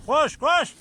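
high, loud shout to the animals; calling out loud. Real, not acted. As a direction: yell it across the field. (yüksek sesle bağırarak)

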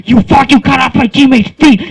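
loud, high outburst; angry mock yell. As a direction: yell it in hammed-up outrage. Retold angry yell at Freddy.

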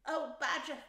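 loud, high cry of appeal. A narrator's reading. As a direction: call out to Badger as a desperate Rat. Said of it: "cried the rat".

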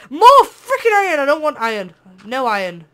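real annoyed yell; exasperated shout. Real, not acted. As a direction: yell it at the rock in disgust. Finds iron again.

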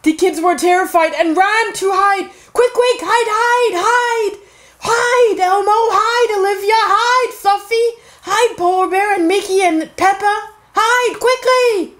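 high, frantic storybook urging; panicked. Acted. Storyteller telling toys and kids to hide, high panic. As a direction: urge everyone to hide in a high, urgent voice.

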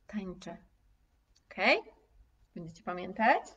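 ordinary talk, nothing marked

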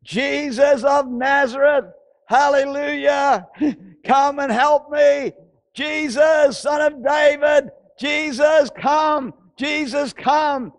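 high, insistent preacher's shout; loud crying out. Acted. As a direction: call out for help at full voice, drawn out. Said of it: "He cried out with a loud voice".

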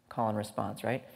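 normal talking voice

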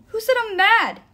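high, loud angry retort; heated shout; yelling. A narrator's reading. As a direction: yell it back in denial. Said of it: "Nico yelled".

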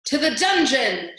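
loud, slow mob shout. A narrator's reading. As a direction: chant it as a crowd. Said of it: "shouted the mice".

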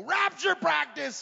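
high, loud, slow shout; dad shouting at supper; sudden yell. Retold or imitated. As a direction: startle the table. Dad yells at family dinner.

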